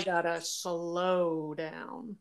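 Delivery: slow, stretched-out advice; deliberately slowed down. Real, not acted. Host telling herself to slow down, slow and drawn out. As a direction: draw the words out slowly.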